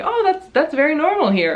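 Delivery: bright, loud, high customer-service chirp; smooth it over with fake positivity; upbeat polite deflection; forced cheer. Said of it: "trying to be very, like".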